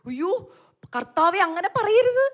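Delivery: high, loud, slow cry of protest; anguished plea. Retold or imitated. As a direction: beg the Lord not to say it. Begging the Lord in shock. (ഉച്ചത്തിൽ, ഉയർന്ന ശബ്ദത്തിൽ കേണപേക്ഷിച്ച്)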